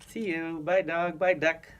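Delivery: ordinary delivery, nothing marked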